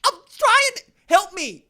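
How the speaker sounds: high, loud pleading; worked-up, insistent begging; exasperated plea. Acted. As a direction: plead for help at full pitch. Exasperated plea for help with names.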